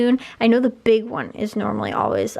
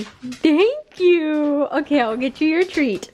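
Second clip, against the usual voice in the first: high, bright praise for a pet; praising a dog; 5 semitones higher, slower than usual. Real, not acted. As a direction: reward the dog sweetly. Praising dog after trick.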